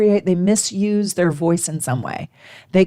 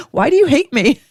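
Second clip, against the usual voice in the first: high, loud, hurt and pleading; wounded whine; 6 semitones higher, 4 dB louder, faster than usual. Acted. Friend who feels unloved whining at the cold speaker, high and hurt. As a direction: play the offended friend, whining.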